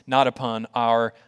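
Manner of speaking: ordinary talk, nothing marked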